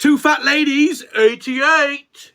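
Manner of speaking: high, slow bingo-caller sing-song. Pretend. Friend jokes back as a bingo caller, high and drawn out. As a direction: call the numbers like a bingo hall host.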